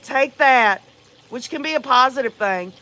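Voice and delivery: ordinary talk, nothing marked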